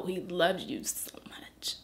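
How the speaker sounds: soft, tearful whisper; choked-up tender assurance, voice fading; overcome with emotion. Real, not acted. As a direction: let the voice give out under feeling. Overcome while assuring her of love.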